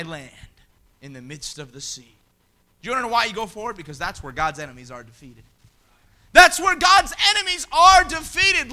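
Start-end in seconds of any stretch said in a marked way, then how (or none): 3.8-5.4 low and soft, almost to himself; quiet. Acted. First, low take of repeated line. 6.3-8.7 loud and high, punching That's; preacher's shout. Acted. Preacher repeats line as shout.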